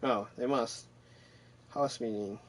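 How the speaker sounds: ordinary delivery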